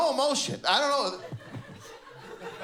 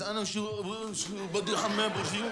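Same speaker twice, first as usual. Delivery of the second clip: softer garbled mimicry, slightly higher; mocking imitation; 3 semitones higher, 4 dB quieter, faster than usual. Man mocking the comic's attempt at Arabic. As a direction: mimic his bad Arabic to tease. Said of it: "he was mocking me".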